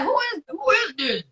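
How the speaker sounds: high, questioning who-is-this; playfully suspicious caller check; imitating a puzzled phone voice; mock suspicious question. Retold phone confusion.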